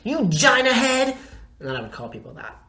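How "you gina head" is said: put-on high, slow childish insult; a little kid calling names; lightly voiced angry child. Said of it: "I said".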